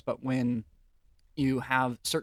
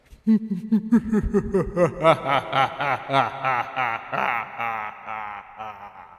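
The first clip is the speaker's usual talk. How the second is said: loud evil laugh with echo; maniacal laughter; 6 dB louder than usual. Shown as a demonstration. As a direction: cackle like a mad villain. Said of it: "I think of maniacal laughter. So let's give that a try".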